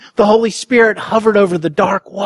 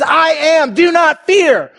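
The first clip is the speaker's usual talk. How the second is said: proclaiming; 7 semitones higher than usual. Acted. Preacher voicing Jesus to disciples, raised and ringing. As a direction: ring out Jesus' words as a proclamation.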